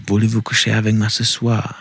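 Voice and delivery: put-on warming up a French accent; playful put-on French; practice French accent. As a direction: try out your French with stock phrases. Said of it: "that was a terrible French accent".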